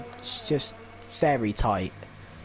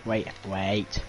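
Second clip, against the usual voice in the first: ordinary talk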